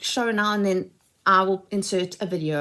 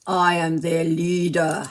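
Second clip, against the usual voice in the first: toy leader voice; 3 semitones lower, slower than usual. Pretend. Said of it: "And he is going".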